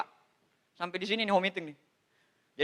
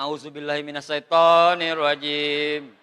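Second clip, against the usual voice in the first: slow, louder solemn recitation; mock prayer against the devil; 7 semitones lower, 6 dB louder, slower than usual. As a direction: recite it like warding off Satan. Bercanda saat istri datang. (lantunan doa pura-pura, pelan dan keras)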